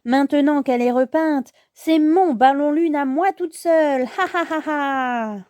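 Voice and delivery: theatrical villain gloat ending in a cackle; gloating villainess. Acted. Sorceline claims the balloon for herself, gloating. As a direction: claim the prize, then laugh wickedly.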